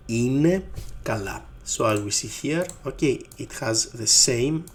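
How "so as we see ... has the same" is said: ordinary talk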